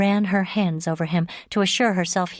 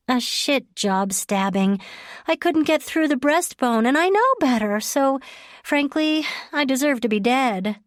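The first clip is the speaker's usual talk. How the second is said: Leah's voice; 8 semitones higher than usual. Acted. Leah voiced higher.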